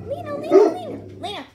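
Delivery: loud, high calls for the dog; real urgent shouting; shouting her name. Real, not acted. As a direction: yell for the dog to stop.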